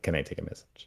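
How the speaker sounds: soft, offhand receptionist brushing him off; sassy receptionist. Retold or imitated. Receptionist softly stonewalling Dan's urgent call, mildly sassy. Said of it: "the most sassiest, uh, receptionist".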